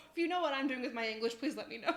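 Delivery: quick plea through laughter; amused, words tumbling out with laughs; laughing. Real, not acted. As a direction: giggle through a mock plea. Laughing at her own English.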